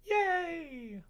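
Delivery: ordinary talk, nothing marked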